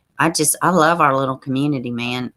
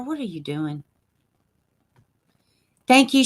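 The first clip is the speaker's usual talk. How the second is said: ordinary talk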